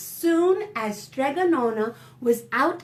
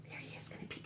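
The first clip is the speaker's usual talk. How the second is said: quick hushed whisper; 22 dB quieter, much faster than usual. Real, not acted. Aside to the listening children.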